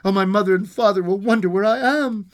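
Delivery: acted childlike worry; anxious cry. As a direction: keep up the worried child's plea. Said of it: "cried the little girl".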